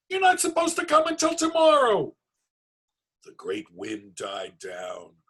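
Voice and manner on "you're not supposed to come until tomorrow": very high panicked shriek. Acted. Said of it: "Herschel shrieked".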